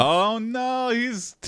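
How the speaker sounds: high, drawn-out cry of dismay; mock dismay. Real, not acted. Player reacting to naked workout twist, high dismay.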